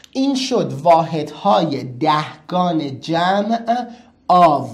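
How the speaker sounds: واحدهای دهگان جمع آو said very slowly, punching آو at the end; slow emphatic answer. Shown as a demonstration. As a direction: drag out the answer and punch the last word. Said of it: "این شد چی؟". (آرام و کشیده، با تأکید روی آو)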